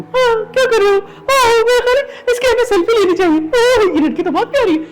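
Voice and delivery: very high, loud cartoon bear voice; Panda. Shown as a demonstration. Dubbing actor shows off his cartoon bear role, high squeaky voice. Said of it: "میرا، میں panda کا کردار کرتا ہوں".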